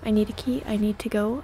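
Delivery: ordinary talk, nothing marked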